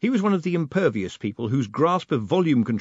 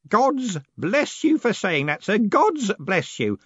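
tearful; 10 semitones higher, 4 dB louder, slower than usual. A narrator's reading. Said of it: "Tears were beginning to roll".

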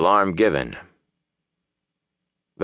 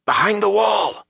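urgent high, strained shout; whispered shout; 13 semitones higher than usual. A narrator's reading. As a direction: try to shout under your breath. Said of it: "he tried to whisper a shout".

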